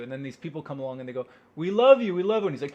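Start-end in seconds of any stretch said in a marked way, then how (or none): 1.6-2.5 loud, high-pitched adoring cry; gushing fans. Acted. People come along to a loner, gushing loudly. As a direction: call it out high, like a crowd of admirers.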